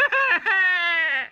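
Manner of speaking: very high sobbing wail; baby dinosaur wail. A narrator's reading. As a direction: let the baby howl out her sob. Baby dinosaur bursts into tears.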